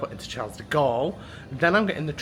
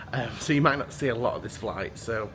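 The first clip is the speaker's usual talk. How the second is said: normal talking voice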